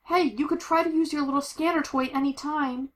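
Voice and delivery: high, urgent sarcastic prod; exasperated, pitched well up; exasperated calling. Acted. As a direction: call impatiently to a partner while fleeing. Acted calling to partner while fleeing.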